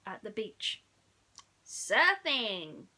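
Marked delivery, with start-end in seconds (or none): 1.6-2.9 loud, gleeful alien call; loud announcement. Acted. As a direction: proclaim it loud. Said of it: "Stitch announced".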